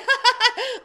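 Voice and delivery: loud high jeering laugh; mocking hoot. A narrator's reading. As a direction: hoot at poor Bubba. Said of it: "hooted and hollered".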